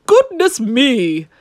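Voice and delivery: a startled farmer just woken; waking farmer. A narrator's reading. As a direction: farmer exclaims, drawn out. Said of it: "The farmer woke and said".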